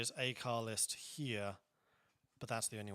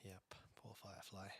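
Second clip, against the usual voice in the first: breathy whispered sympathy; 3 semitones lower, 16 dB quieter than usual. Real, not acted. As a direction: whisper it like a sad aside.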